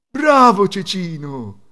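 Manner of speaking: loud, drawn-out, high-pitched praise; proud father. A narrator's reading. Father praising his newfound son, loud drawn-out cheer. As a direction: call out slow, hearty praise. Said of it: "disse il padre".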